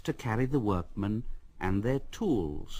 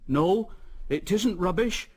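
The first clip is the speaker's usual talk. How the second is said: insistent workmen; 8 semitones higher than usual. A narrator's reading.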